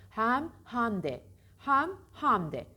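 slow, exaggerated "häm, hämde" for hem; mock the over-open E in hem; wrong open E. Shown as a demonstration. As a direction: hem said as "ham", wide and drawn out. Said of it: "On kişiden dokuzunda bunu duyuyorum".

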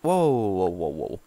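loud wow of amazement; amazed. Real, not acted. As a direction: react in real awe. Amazed at a discovery.

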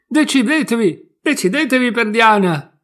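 very loud, high shout; brash loud demand; shouting at full voice. A narrator's reading. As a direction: yell it impatiently. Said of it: "gridò a gran voce".

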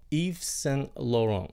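"Yves Saint Laurent" slow, like "eev sang lo-rong"; French name demo. Shown as a demonstration. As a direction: model "Yves Saint Laurent" with silent final t's. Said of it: "Chữ cuối phát âm nó là Laurent".

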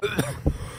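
sudden harsh cough; coughing fit. Real, not acted. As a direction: cough out a swallowed bug. Swallowed a bug.

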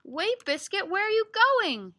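loud, high storybook call; calling after a puppy. Acted.

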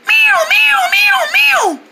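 high repeated siren wail; shrieking bird distress call, like a fire alarm; alarm-like bird cry. Acted. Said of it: "It sounded like a fire alarm".

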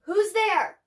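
high, loud call into the trees; shouted. A narrator's reading. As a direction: shout out to find the hidden voice. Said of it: "Min Lee shouted".

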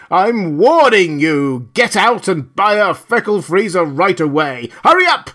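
loud, high, furious barking; enraged scream. A narrator's reading. Loudspeaker ordering the block to buy now, screaming with rage. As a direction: shout orders in a rage, building to repeated cries. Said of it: "The voice screamed inarticulately with rage".

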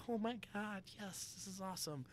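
high, excited squeals of delight; giddy. Retold or imitated. Buzzed twenty-year-old naked on the dock, giddy high squeal. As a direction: gush like a giddy drunk kid. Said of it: "running around there all giddy".

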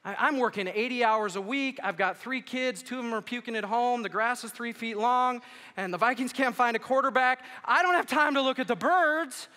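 high, loud, overwhelmed tirade; hammed-up stressed-out parent venting; exasperated rant. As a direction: build to a shouted, stretched-out finish. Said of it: "You're probably thinking".

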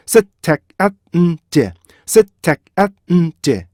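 셋, 택, 앳, 음, 제 as five separate beats; staccato syllable-by-syllable demo; syllables split apart. Shown as a demonstration. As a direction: break it into five evenly timed syllables. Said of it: "이렇게 먼저 분리를 하시고요".

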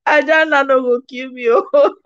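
high-pitched, laughing protest. Real, not acted. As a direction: say it through real laughter. Laughing at a joke or comment.

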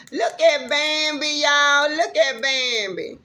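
excited. Real, not acted. Vlogger thrilled to spot a deer outside, calling viewers. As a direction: call out in delight at the deer.

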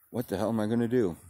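usual speaking voice

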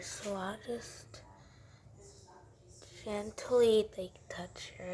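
real whisper so the cat stays asleep; hushed whisper beside a sleeping cat. Real, not acted. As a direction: breathe it out without voice. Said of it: "I don't wanna, like, wake her up".

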